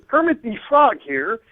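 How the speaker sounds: slow, laid-back frog puppet voice; easygoing puppet frog; Kermit the Frog. Shown as a demonstration. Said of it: "similar to Kermit the Frog".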